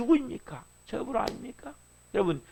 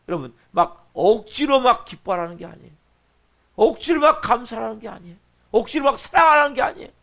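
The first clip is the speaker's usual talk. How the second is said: usual speaking voice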